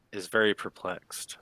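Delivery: ordinary talk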